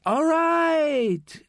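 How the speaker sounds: a high anxious "right" held long then dropped; jumpy high-voiced "All right"; nervous all right. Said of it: "Manolo says to the nun".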